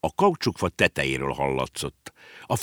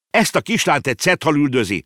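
put-on high, urgent boy's voice; boy explaining; 8 semitones higher, 4 dB louder, faster than usual. Boy explaining the whale chase to the horse, urgent. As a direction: explain in a hurry of alarm. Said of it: "magyarázta Konrád".

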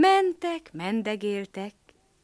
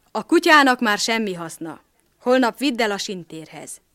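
stern farmer; 2 semitones higher, 8 dB louder than usual. Acted. Farmer orders dog's disposal.

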